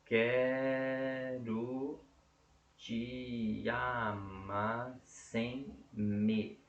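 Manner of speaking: lento, sílaba por sílaba; escansão silábica. Shown as a demonstration. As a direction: marque cada sílaba devagar. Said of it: "Por que eu contei até o me?". (escandindo o verso bem devagar)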